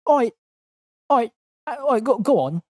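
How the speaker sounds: high, cheery cockney copper waving him on; mock British officer. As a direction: wave him through like a daft bobby. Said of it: "the officer checks the license".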